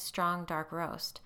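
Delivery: ordinary delivery, nothing marked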